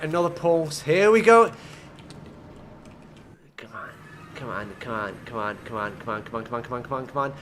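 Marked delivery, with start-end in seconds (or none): 3.6-7.3 soft, lower pleading chant; quiet urging. Real, not acted. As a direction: beg it on quietly. Urging the carts on.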